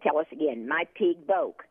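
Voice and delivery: normal talking voice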